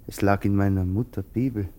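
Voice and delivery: usual speaking voice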